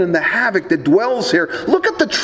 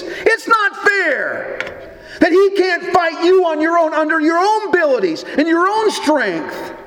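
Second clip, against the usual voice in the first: seething little voice; 8 semitones higher than usual. Retold or imitated. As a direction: drag out the sulky complaint. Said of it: "in that seething little voice".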